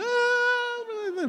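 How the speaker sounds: very high, loud panicked scream; mock scream. Palestinians fleeing charging camels, mock screaming. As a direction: scream in comic terror. Men flee running camels.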